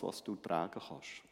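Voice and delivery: ordinary talk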